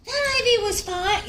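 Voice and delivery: high, loud defensive retort; snapping back. Retold or imitated. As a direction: fire it right back at him. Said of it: "react in that same manner".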